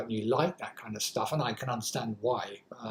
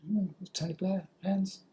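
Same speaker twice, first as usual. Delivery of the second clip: normal talking voice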